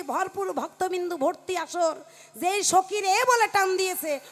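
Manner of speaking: ordinary talk, nothing marked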